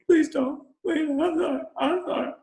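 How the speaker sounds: hammed-up begging for mercy; terrified man begging for his life, pleading. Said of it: "who's shivering and crying".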